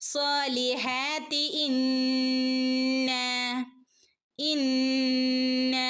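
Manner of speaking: ഇന്ന with a long humming nasal; ghunna. Shown as a demonstration. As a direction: hold the ന്ന hum long. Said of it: "നൂനിന് ശ്രദ്ധയുണ്ട്". (ഇന്ന ഗുന്നയോടെ)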